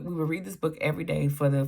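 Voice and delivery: normal talking voice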